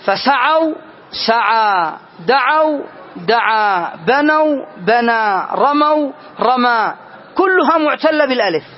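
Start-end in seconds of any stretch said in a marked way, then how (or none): 0.0-6.9 slow, each verb set beside its root form; slow listing. Shown as a demonstration. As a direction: spell out the pairs slowly. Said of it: "لو رجعت إلى أصلها". (عرض بطيء للأفعال وأصولها)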